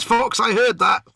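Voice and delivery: loud, slow mock telling-off; mock loud callout. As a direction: bark it back like you caught him. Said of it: "McLeish says".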